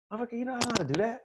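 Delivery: high, quick, softened outburst; suppressed, incredulous muttered retort; indignant inner voice. Acted. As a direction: think it aloud, fast and affronted. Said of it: "in your mind, you're like".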